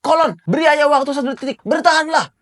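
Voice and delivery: a father rushing to save his son; urgent father. Acted. Father urging his son to hold on, urgent and fast. Recapper voices father Gaban.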